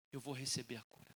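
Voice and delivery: very soft, low murmur; hushed resolve. Acted. Woman telling herself she will be healed, hushed resolve. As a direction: say it privately, almost under the breath.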